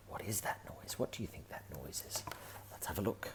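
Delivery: ordinary delivery, nothing marked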